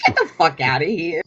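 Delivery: ordinary delivery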